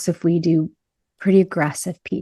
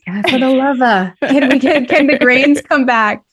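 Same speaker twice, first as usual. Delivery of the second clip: higher and louder, hammed-up begging; mock desperate plea; 6 semitones higher, 6 dB louder than usual. Carb makers pleading for grains to return, mock desperate and loud. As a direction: beg like a struggling carb seller. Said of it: "people who manufacture carbs are like".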